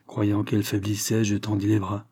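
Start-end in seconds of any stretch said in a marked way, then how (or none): none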